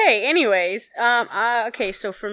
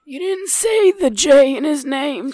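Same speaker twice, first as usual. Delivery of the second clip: zealous Trump fan; 8 semitones higher than usual. Acted. Mock Trump fan scolding.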